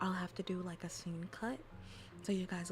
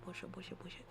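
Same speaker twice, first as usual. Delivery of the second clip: usual speaking voice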